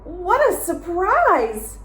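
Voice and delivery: high, slow, loud astonishment; startled Goldilocks. A narrator's reading. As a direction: stretch out the shock of waking.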